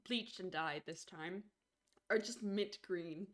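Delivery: ordinary talk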